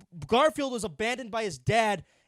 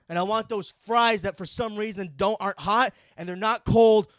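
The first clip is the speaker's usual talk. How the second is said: mock loud rant; 8 dB louder than usual. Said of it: "wakes up in the morning and says".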